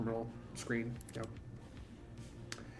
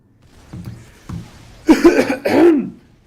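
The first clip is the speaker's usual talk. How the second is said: loud hacking cough; harsh cough; 21 dB louder than usual. Real, not acted. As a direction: cough hard and rough. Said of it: "excuse me for coughing".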